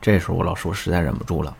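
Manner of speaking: ordinary talk, nothing marked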